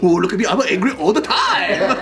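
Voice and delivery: loud, high whiny mockery, stretched out; mocking taunt. Parent mocking the son's anger complaint, sing-song taunt.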